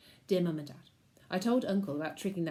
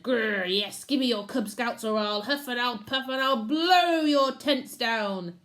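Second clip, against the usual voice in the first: loud growly threat, a bit higher; growling big bad wolf; 4 semitones higher, 6 dB louder, slower than usual. A narrator's reading. Uncle threatening the pack leader, growling. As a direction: huff and puff menace.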